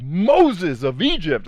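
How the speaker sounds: slow, high, loud exasperated cry; skit Jesus exclaiming. Acted. Jesus in a comedy skit, drawn-out exasperated cry. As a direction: stretch it out like an exasperated prophet. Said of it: "what did Jesus say? in my little skits".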